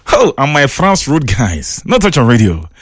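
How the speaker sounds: ordinary talk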